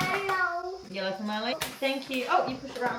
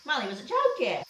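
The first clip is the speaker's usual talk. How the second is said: normal talking voice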